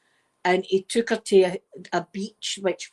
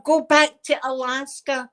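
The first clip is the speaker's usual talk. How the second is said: higher, slow, drawn-out yell; mock shout; 5 semitones higher, 3 dB louder than usual. Ozzy shouting at the tide to go back, loud and slow. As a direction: order the waves back home. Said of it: "He's shouting to the".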